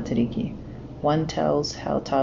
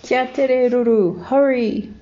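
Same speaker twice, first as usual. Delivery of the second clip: high, loud hurry-up call; urgent call; 8 semitones higher, 5 dB louder, slower than usual. A narrator's reading. Kiwi urging friend to hurry.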